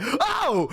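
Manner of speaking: high re-enacted cry of shock; startled "oh". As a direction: imitate recoiling with a high "oh". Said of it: "Like that".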